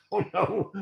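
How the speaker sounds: laughing it out, high; laughing reply. Real, not acted. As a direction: giggle through the words. Laughing while repeating a reply.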